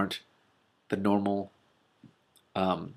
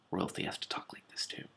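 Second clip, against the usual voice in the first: whispered aside; 13 dB quieter than usual. Acted.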